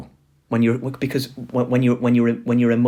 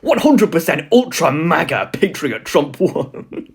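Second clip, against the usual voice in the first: mock MAGA bio; 3 semitones higher, 5 dB louder, slower than usual. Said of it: "here's some profiles".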